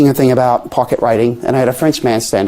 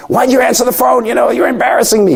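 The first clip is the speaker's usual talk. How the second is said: mock angry caller; 9 semitones higher than usual. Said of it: "they're yelling at me and going".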